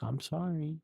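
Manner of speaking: puppy-dog apology. Retold or imitated. Nikki apologizing to Bear, mock puppy-dog apology. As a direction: plead it like a guilty puppy. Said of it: "those sad puppy dog eyes".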